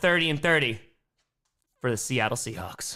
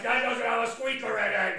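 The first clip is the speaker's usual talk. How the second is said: voicing the dog; 8 semitones higher than usual. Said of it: "He's like".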